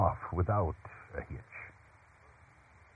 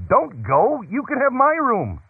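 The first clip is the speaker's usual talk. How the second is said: high, raised, urgent cry; calling out; 4 dB louder than usual. A narrator's reading. Boy innkeeper calls after departing Mary and Joseph, crying out. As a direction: call after them, pitched way up. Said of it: "Then he called out".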